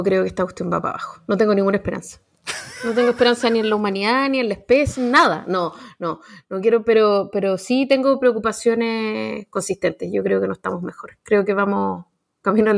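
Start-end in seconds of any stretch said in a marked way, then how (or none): none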